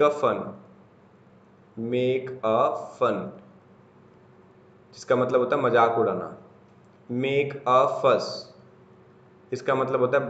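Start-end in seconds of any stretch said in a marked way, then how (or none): 1.9-3.4 MAKE, A, FUN slow and evenly separated; word-by-word. Shown as a demonstration. Teacher repeating an example phrase for students, word-by-word. 7.1-8.2 MAKE, A, FUSS slow and separated; word-by-word. Shown as a demonstration. Teacher introducing a new phrase to students, word-by-word.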